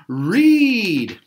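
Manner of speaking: big, high shout of the answer; group shout. A narrator's reading. The kids shout the answer together, loud and high. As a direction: yell it out all together. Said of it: "they all shouted".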